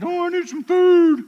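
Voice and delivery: high, loud whine for food; whining puppy. Acted. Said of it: "when he whined and said".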